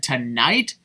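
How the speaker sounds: ordinary talk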